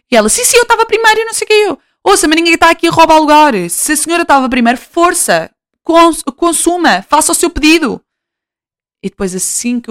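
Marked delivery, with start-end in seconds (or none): 0.3-1.8 much higher, fast insistent protest; indignant lady. Retold or imitated. Lady insisting she was first, much higher fast protest. 2.0-8.0 higher, pointedly polite and irritated; be sharply polite to a rude customer; passive-aggressive politeness. Retold or imitated. Vlogger to lady in queue, higher pointed politeness. Retold annoyed reply to lady.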